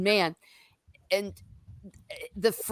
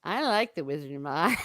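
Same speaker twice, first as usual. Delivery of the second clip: imitating weirdo kid. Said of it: "He's all".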